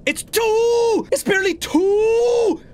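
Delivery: high, drawn-out whine; high-pitched cry of dismay; despairing wail. Real, not acted. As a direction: wail it high, stretching the last word. Despair at the game clock.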